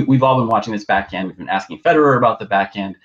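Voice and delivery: usual speaking voice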